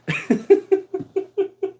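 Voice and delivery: high-pitched cackle of amusement; high squeaky laugh. Real, not acted. Storyteller laughing at the freaked-out nurse, high squeak.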